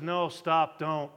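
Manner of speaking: dry, low, sarcastic no stop don't; deadpan sarcastic warning. Retold or imitated. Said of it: "that sarcastic to".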